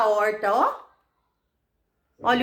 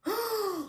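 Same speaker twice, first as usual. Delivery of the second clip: scared gasp; 10 semitones higher, 8 dB quieter than usual. Shown as a demonstration. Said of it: "como que está essa expressão?".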